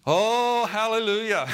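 high, loud, drawn-out shout of praise; exultant praise. Real, not acted. Preacher rousing the congregation to rejoice, exultant praise. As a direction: burst out jubilantly, breaking into laughter after.

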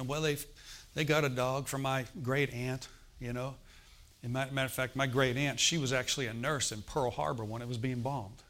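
ordinary talk

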